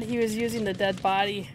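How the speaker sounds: ordinary talk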